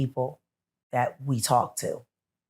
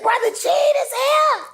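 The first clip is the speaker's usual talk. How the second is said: much higher, louder, slow excited shout; 8 dB louder than usual. Retold or imitated. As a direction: yell it like a thrilled fan. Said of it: "He said".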